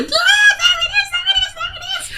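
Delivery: put-on high, fast kid shout; excited kids. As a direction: shout it like a thrilled child pointing. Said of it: "The kids are pointing and laughing".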